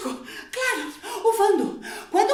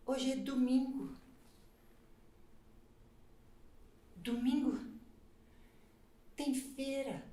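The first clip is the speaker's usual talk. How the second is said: drowsy and soft; 5 semitones lower, 9 dB quieter than usual. Acted. Drowsy soft Sunday reverie.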